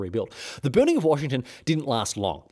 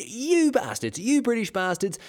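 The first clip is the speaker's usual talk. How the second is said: high, mock-furious telling off; a superintendent roaring at British troops, hammed up; angry superintendent; 10 semitones higher than usual. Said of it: "He said, and he said".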